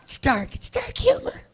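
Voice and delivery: high puppy voice explaining the joke; the dog defends its joke in a squeaky pitch. Pretend. Dog Waylon explaining its own joke, high squeaky voice.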